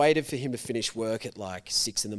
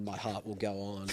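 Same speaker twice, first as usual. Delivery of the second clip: usual speaking voice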